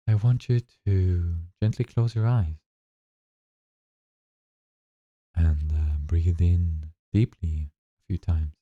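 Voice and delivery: slow, calm meditation guide voice; guided meditation. Acted. Guide leading listeners through their first breathing meditation, slow and calm. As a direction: ease them into the breath, unhurried and gentle.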